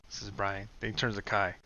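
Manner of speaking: normal talking voice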